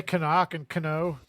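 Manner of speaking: Canuck and Cano as kuh-nock and kuh-no; silly kuh-nock, kuh-no; sounded silent K. Shown as a demonstration. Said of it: "I'll say Canuck and Cano".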